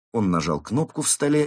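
ordinary delivery, nothing marked

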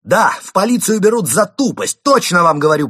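high, loud taunting; almost shouting. A narrator's reading. Константин provoking the police, almost shouting. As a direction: nearly yell it, egging them on. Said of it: "почти кричал Константин".

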